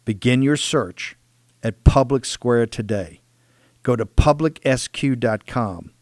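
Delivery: slow, measured sponsor read; slow down and punch the brand name. Acted. Host urging listeners to begin their search, ad read.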